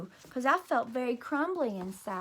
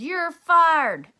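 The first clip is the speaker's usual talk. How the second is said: higher and louder, a bossy boss voice; mock boss firing; 6 semitones higher, 9 dB louder than usual. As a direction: bark it like a big company boss. Boy teaches words like a boss.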